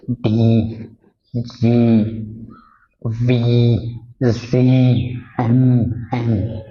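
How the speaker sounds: each voiced consonant said alone, slow and distinct; voiced sounds demo. Shown as a demonstration. Teacher isolating voiced consonants for students, slow and distinct. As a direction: let the vocal cords buzz on every letter. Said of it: "उनको voiced कहते".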